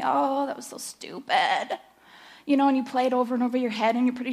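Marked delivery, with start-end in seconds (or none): 0.0-1.8 self-scolding. Retold or imitated. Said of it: "My perfectionist part of me".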